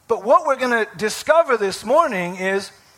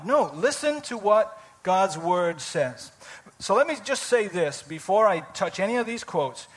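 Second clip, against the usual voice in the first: usual speaking voice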